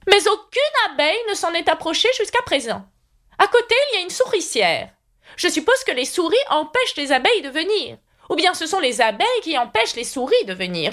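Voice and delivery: put-on high, fussy old knight voice; high knightly voice promising to escort Alice. A narrator's reading. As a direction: play the kindly, dotty knight up high. Knight rambles.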